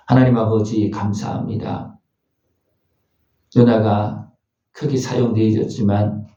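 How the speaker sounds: low-pitched, reverent congregational prayer; closing prayer. Real, not acted.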